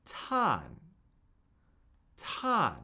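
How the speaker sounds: fourth-tone "tan", a clean downward fall. Shown as a demonstration. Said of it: "a steady fall down".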